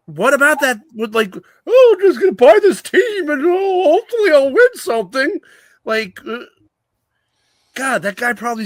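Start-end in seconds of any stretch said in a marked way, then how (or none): 1.7-5.4 high, loud, hammed-up crybaby voice; mock a naive rich guy in an exaggerated high whine; mock whiny buyer. Host mocking an owner buying a team, high mock whine.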